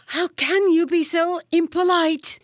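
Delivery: high, loud outraged rebuke; shocked scolding. A narrator's reading. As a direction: scold him in disbelief.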